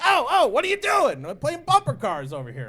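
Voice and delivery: high, loud mock outrage; imitated yelling kid. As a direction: yell at careless shoppers like an indignant child. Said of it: "my kid's yelling at people".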